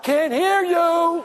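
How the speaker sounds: high, slow, drawn-out shout; mock heckler's holler; heckling yell. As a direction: yell it out at the stage, stretching each word. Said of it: "he even yelled out".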